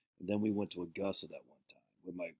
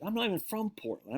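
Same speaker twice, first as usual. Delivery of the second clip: high indignant protest; 12 semitones higher than usual. Retold or imitated. Islander insisting he is not from Portland, high indignant protest. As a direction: protest like an offended kid. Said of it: "I was like".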